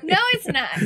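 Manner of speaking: laughing through the denial. Real, not acted. Laughing denial of his joke.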